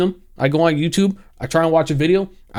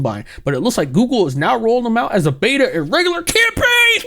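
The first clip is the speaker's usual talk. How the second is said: mock excitement; 3 semitones higher than usual. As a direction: read the post like breaking good news. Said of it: "He is super excited".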